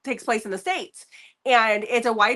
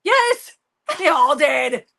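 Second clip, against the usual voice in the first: laughing; 4 semitones higher, 5 dB louder, slower than usual. Real, not acted. Laughing at the bad accents.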